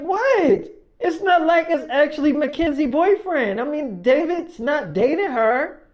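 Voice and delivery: high-pitched defensive outburst, acted out; mock indignant protest. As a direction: protest as if wrongly accused. Acted daughter protesting to mother.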